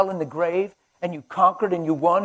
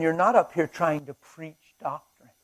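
ordinary delivery

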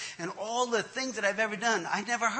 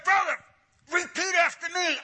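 imitated preacher command; 10 semitones higher than usual. Pastor gripping man's face, high commanding voice. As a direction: order it like a zealous pastor.

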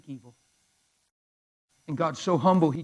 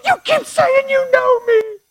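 very high, loud, slow shriek; imitated freak-out; 11 dB louder, slower than usual. Woman challenges pastor who claims to know her, imitated freak-out. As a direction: scream it in hysterical imitation. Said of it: "she freaked out".

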